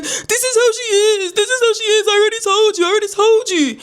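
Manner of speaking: high, whining wail; put-on high voice, stretched and plaintive; mock upset son. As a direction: imitate him crying out in exasperation. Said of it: "And he's like".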